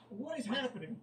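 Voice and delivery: high-pitched incredulous question; bewildered disbelief. Real, not acted. Reader reacting to a horny message, high-pitched disbelief. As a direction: pitch jumps up in confusion.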